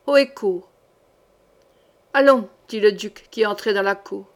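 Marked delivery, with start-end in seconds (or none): 0.1-0.7 a second guard repeats the cry; a lightly different voice calling it back; echoed order, another soldier. A narrator's reading. Guard echoing the captain's order, a lightly voiced call. 2.1-2.5 a sharp command, louder; the duke calls out as he strides in. A narrator's reading. Duke entering the courtyard to hurry the hanging, a loud command.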